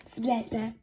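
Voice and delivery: plain reading voice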